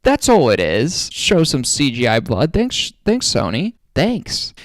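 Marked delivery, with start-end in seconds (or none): 2.5-4.5 high, rising fake gratitude; dripping sarcasm at the studio; sarcastic thank-you to Sony, pitch rising. Real, not acted.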